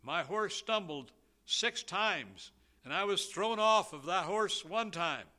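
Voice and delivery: preacher voicing the son telling his father about the road, excited; excited exclaim. A son's breathless report, slowed on the stumbles. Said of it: "he exclaimed".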